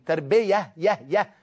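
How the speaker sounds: "تربية" ending in a light, undoubled "يه"; unstressed ya. Shown as a demonstration. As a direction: say "تربية" with a single short y, then repeat "يه". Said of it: "ده مصدر رباعي مش مصدر صناعي".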